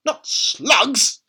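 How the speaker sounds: high, loud horrified cry; boy crying out at grandma; cried out. A narrator's reading. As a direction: yelp in disgust. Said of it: "George cried out".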